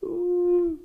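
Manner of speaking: tearful broken voice, high; crying. Retold or imitated. Sob the words out to God. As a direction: pastor retelling his prayer to God, crying. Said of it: "Terus saya dengan nangis".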